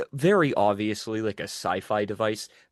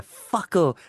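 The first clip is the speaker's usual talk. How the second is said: put-on much higher and louder, a toddler's blurt; child's loud outburst; 8 semitones higher, 6 dB louder than usual. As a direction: blurt it up high like a three-year-old. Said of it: "she just kept going".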